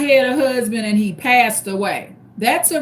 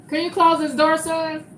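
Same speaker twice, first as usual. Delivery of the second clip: calling out; 6 semitones higher than usual. Real, not acted. Calling to son in another room.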